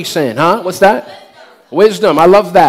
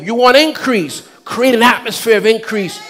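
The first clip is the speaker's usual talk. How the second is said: ordinary delivery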